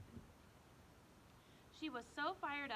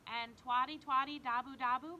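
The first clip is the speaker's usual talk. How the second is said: pointed mom; 9 dB louder than usual. Acted. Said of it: "Mom said".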